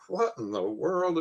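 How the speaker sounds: slow, high, baffled; a man staring at a meter he can't believe; puzzled exclaim. Retold or imitated. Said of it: "he was like".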